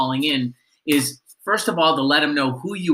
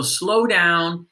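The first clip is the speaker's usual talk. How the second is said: slowed down; 2 semitones higher than usual. Shown as a demonstration. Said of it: "When we speak too quickly".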